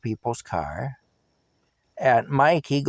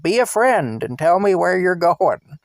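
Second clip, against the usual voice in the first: raised, pleading voice, pitched up; pleading louder; 7 semitones higher, 5 dB louder than usual. Retold or imitated. Taxi driver pleading with drunk passenger, raised and high. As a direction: beg him louder and higher.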